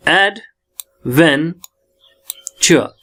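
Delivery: "Adventure" very slowly as ad, ven, chuh; long pauses between all three syllables of "adventure". Shown as a demonstration. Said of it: "तो तीन syllable". ("Adventure" तीन हिस्सों में, बहुत धीरे)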